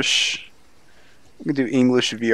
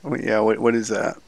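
ordinary delivery

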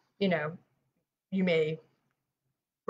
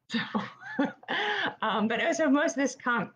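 laughing; 9 semitones higher than usual. Real, not acted. Laughing at own joke.

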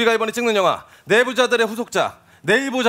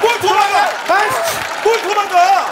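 angry shouting; 10 semitones higher, 5 dB louder, slower than usual. Real, not acted. Director yells at extra.

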